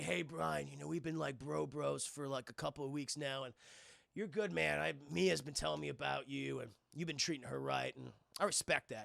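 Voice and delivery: low, soft tough-guy voice; a calm brother-figure speaking low; Dom, low and laid-back. Acted. Dom praising Brian for treating Mia right, low and calm.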